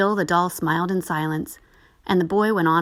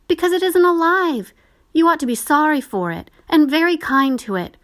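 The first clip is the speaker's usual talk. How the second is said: much higher, louder pleading sister; earnest girl; 10 semitones higher, 6 dB louder than usual. A narrator's reading. Sister pleads with her brother, much higher and louder. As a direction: beg him to pity the doll.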